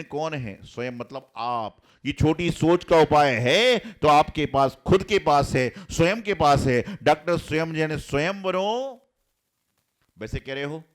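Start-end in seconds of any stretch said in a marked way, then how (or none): 2.1-8.9 loud, slow emphatic preaching; sermon climax; forceful preaching. Acted. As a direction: hammer each phrase.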